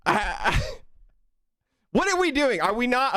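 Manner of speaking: exasperated. Real, not acted. Overwhelmed by gifted subs.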